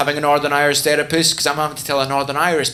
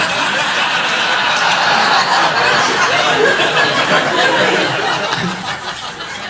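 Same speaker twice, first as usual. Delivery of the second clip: long high laughing fit; laughs; 10 semitones higher, 3 dB louder than usual. Real, not acted. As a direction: laugh hard after the punchline. Laughing after the punchline.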